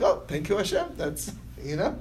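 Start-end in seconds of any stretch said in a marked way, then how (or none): 0.0-0.9 high, bright burst of thanks; grateful exclamation. Retold or imitated. A woman thanking Hashem for the repaid debt, high and gushing. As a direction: gush thanks to God, pitch leaping up on the name. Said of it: "So she's like".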